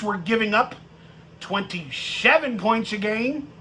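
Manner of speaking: ordinary talk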